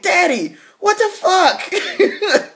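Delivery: high, laughing "what the fuck" at dad; laughing outburst. Retold or imitated. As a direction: squeal it through laughter at her father. Retold outburst at father, laughing.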